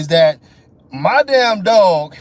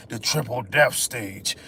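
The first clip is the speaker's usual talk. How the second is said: low, slow announcer voice; mock announcer; 10 semitones lower, 6 dB quieter, slower than usual. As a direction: boom it like a game trailer. Mock video-game announcer.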